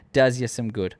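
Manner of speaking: ordinary talk, nothing marked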